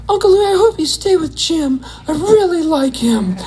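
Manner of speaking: put-on high, pleading little-kid voice. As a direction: beg like a small child.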